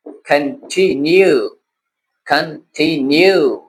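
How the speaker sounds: Continue slowly in three parts, kan-ti-nyu; broken into syllables. Shown as a demonstration. Teacher helps learners with big words, broken into syllables. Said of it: "syllable बना लिया करे".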